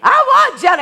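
put-on high, loud child wail; a toddler shrieking for jelly; whiny two-year-old demanding jelly; crying child.

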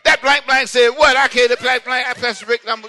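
loud, high hammed-up indignation; mock cussing outburst. Churchgoer cussing once outside the door, mock outrage. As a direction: rant in comic outrage with censored swearing.

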